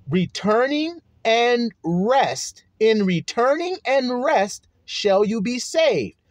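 slow, drawn-out scripture stress on "Returning and rest"; slow emphasis. Shown as a demonstration. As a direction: lean on each word of "In returning and rest", slowly. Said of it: "I'm emphasizing it".

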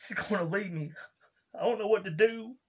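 put-on whimpering about his girlfriend, half-hearted sobs; crying type rager. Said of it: "like crying type emotional".

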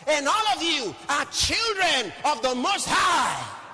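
high, loud, slow proclamations; exultant preacher shouting scripture; full-force preaching. Real, not acted. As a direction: hammer out each phrase and let it ring.

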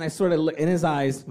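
usual speaking voice

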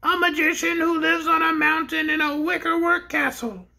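the pink bear answers the king; small shrill voice. Acted.

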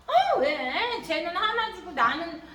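mock whiny child's complaint; grumbling. Child complaining another got one, exaggerated grumbling whine. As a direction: whine with swooping pitch, dragging the last words. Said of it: "이러고 툴툴툴 거리는".

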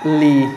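লি as one drawn-out "lee"; pronunciation model. Shown as a demonstration. Teacher giving the double-L rule, long "lee". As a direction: say double L as a single clear লি. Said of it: "আপনি সবসময় কি বলবেন?".

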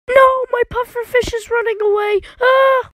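high, fast panicked cry. Acted. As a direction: shriek in panic as it escapes. Pufferfish escaping, owner panics.

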